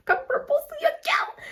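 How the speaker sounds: very high, teasing sing-out; playful high call. Pretend. Woman asks future husband to propose, playful high voice. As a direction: jump way up in pitch, playfully.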